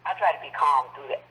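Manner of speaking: ordinary delivery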